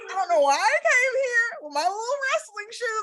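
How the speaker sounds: high, whimpering fake sob; hammed-up teary wail over the little shoes; mock crying whine. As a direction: whine it like a kid crying after class. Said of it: "a little angry cry".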